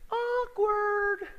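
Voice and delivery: high squeaky awkward; mocking his own awkward moment; comic awkward. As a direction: squeak it out as a punchline. Comic beat after silence.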